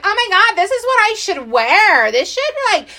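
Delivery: high, thrilled gush; excited. Retold or imitated. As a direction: gasp with delight at the find. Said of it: "And I was like".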